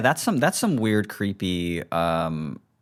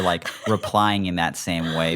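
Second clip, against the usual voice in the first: ordinary talk, nothing marked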